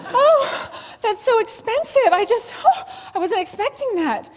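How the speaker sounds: high, loud, flustered protest; a high, loud fluster over the cost; flustered shock. Retold or imitated. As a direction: blurt it out, shaken by the bill. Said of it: "Trigger, here it comes".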